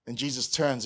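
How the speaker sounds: normal talking voice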